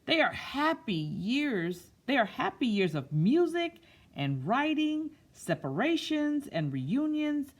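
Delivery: put-on slow, expressive read-aloud to children; storybook reading. A narrator's reading. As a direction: read it like story time, savouring each word. Reads picture book aloud.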